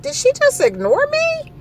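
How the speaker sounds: outraged she'd dare ignore you; indignant disbelief. Retold or imitated. Ignored by the clerk.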